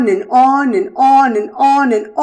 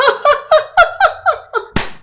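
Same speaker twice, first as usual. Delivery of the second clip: long high giggling cackle; gleeful rabbit laugh. Acted. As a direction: laugh like a trickster who won. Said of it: "he continued to laugh".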